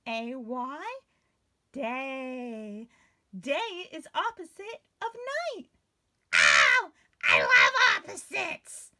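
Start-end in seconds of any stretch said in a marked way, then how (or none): 6.3-8.9 loud, thrilled squeal of joy; excited puppet cheer. Acted. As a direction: burst out loving opposites. Puppet excited about opposites.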